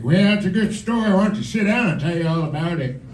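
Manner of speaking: lightly voiced old-timer; hundred-year-old storyteller. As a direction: put on a hint of a very old person spinning a yarn. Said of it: "over a hundred years old".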